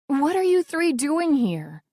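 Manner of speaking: taken aback by sudden visitors; balking. Acted. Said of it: "I balk".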